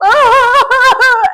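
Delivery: long, loud, high mock wail; theatrical, high-pitched howl of distress; dramatic wail. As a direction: cry out in hammed-up despair. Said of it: "I was so dramatic as well".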